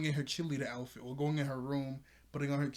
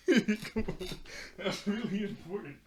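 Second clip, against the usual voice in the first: higher-pitched quote through laughter; laughing; 6 semitones higher, 3 dB louder, slower than usual. Retold or imitated. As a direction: crack up while imitating a pleading cheerleader. Cracking up while quoting Sissy.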